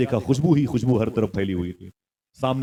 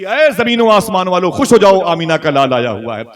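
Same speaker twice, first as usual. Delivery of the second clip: grand proclamation; 7 semitones higher, 9 dB louder than usual. Acted. Heavenly voice proclaiming the birth to all, booming. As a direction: call it out to heaven and earth.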